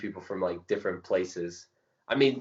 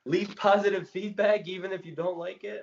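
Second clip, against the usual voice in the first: mock pleading; 11 semitones higher than usual. Plea to viewers for feedback.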